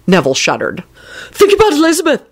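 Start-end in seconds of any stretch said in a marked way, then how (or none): 1.3-2.2 high, urgent interjection; hurried plea. A narrator's reading. Neville heading off the boss's violence, urgent plea. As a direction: jump in fast on a raised pitch. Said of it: "he said quickly".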